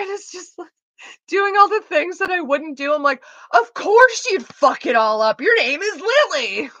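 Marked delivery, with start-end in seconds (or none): 3.5-6.7 loud, high, drawn-out exasperated yell. Retold or imitated. Blaming the heroine for her name, exasperated yell. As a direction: holler it with full exasperation, stretching the words. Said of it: "why are you yelling".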